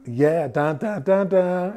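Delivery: ordinary talk, nothing marked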